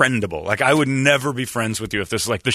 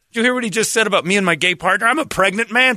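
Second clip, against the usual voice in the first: higher, fast, put-upon patient; hammed-up indignant patient; offended patient; 8 semitones higher, 3 dB louder, faster than usual. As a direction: rattle it off as an outraged patient.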